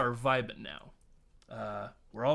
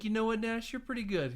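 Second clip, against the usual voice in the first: mock admiring high voice; 9 semitones higher than usual. Retold admiring guard.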